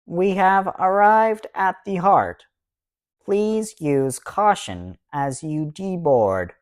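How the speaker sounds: automated announcement voice. A narrator's reading. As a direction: announce it like a synthetic transit system. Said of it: "an automated voice speaks overhead".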